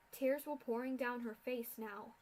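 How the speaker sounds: ordinary delivery, nothing marked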